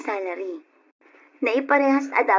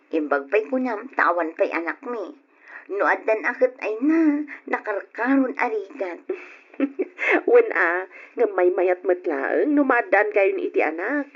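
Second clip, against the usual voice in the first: ordinary talk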